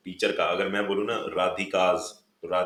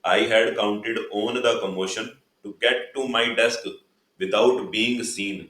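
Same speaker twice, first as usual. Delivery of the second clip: slow, louder reading of the line; slow read-aloud; 2 semitones higher, 4 dB louder, much slower than usual. Shown as a demonstration. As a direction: read it out phrase by phrase. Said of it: "teacher reads the text aloud". (धीमे और ऊँचे स्वर में पढ़ना)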